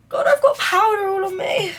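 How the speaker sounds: high, loud cry of dismay; dismayed exclamation. Real, not acted. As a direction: exclaim at a sudden mess, voice shooting up. Sudden complaint about spilt powder.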